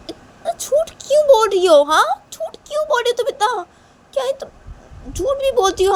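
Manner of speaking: very high, incredulous scolding mother; accusing mother. Acted. Mother accuses Shanaya of lying, high-pitched scolding. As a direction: scold a daughter in shocked disbelief.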